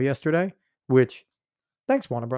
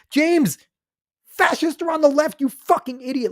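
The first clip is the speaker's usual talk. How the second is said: much higher, scornful outburst; mock him in fury; high angry rant; 12 semitones higher than usual. Real, not acted.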